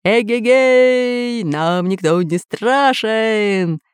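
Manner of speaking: long-drawn triumphant holler; drawn-out pirate whoop; shouting. Acted. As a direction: call out slowly, stretching every vowel. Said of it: "кричал Бульбуль".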